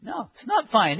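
normal talking voice

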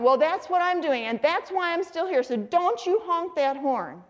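high-pitched irked wife, slowing on the warning; scolding mother. Retold or imitated. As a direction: imitate a wife laying down the law. Acted quarrel, mother scolds.

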